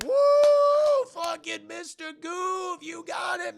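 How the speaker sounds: high strained mock shout; cheering crowd. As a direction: whoop for the trick like a fan. Crowd cheers Goofy's landing.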